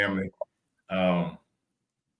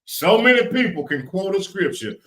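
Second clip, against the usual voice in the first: louder, higher preaching push; preaching emphasis; 8 semitones higher, 6 dB louder than usual. Real, not acted. Host preaching to viewers, louder and higher. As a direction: raise it like a preacher.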